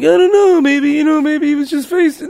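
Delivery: squeaky mock calming; mock high reassuring. His own reply, high.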